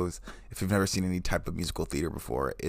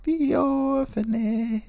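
very high, slow falsetto voice for the wife; voicing his wife; 5 dB louder, much slower than usual. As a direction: draw out the answer in a lofty high voice. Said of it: "And they're like".

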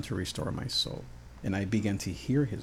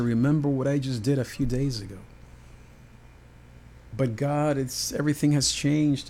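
a frantic prophet crying out to God; desperate Elijah; 4 semitones higher than usual. Acted. Elijah's distressed complaint to God.

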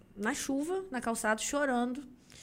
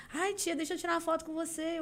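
put-on higher-pitched child pleading for a photo; imitated child; 6 semitones higher, faster than usual. Child asks auntie for a photo, imitated high voice. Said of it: "Aí vem a criança".

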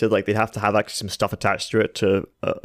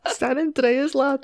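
much higher squeaky northern lad voice; mock Yorkshire lad; slower than usual. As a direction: copy the joke, squeak it up northern. Joining the mock accent joke.

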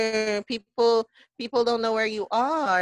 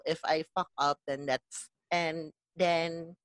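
ordinary delivery

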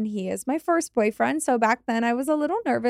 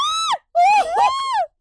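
high shocked shriek of laughter; 5 dB louder than usual. Real, not acted. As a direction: burst out in a screaming laugh. Shocked laughter at scary story.

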